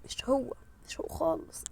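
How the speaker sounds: soft, unvoiced denial; whisper. Real, not acted. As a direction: breathe it quietly.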